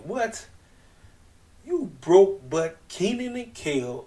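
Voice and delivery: high, slow sneering taunt; mock Ricky Starks jab. Imitating a wrestler taunting rivals on the mic. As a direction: drag out the insult with a sneer.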